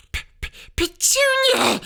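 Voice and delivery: high, loud stammering gasp of panic. A narrator's reading. Husband gasping his wife's name in shock, stammering. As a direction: stutter out her name in shock. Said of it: "he gasped".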